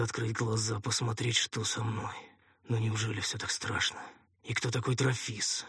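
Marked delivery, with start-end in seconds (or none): none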